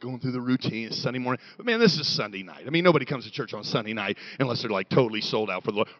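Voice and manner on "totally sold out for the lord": ordinary talk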